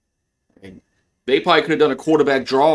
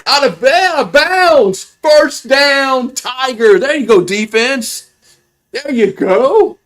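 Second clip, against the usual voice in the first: higher and louder with every yard, building to a shout; excited play-by-play; 9 semitones higher, 6 dB louder than usual. Real, not acted. Fan calling his team's interception return, rising excited shout. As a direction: cheer the pick-six run home like a hype announcer.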